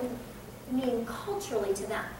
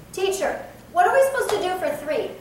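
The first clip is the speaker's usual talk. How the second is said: Teacher, called out high and louder, blunt; slightly rude; 7 semitones higher, 7 dB louder than usual. Pretend. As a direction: address the teacher a bit rudely. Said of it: "kind of rude".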